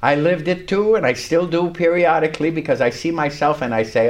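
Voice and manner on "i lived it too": ordinary delivery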